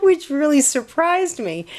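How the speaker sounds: high-pitched, talking through real laughter; cracking up. Real, not acted. Telling viewers about his text, cracking up. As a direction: break into a long giggle while telling it.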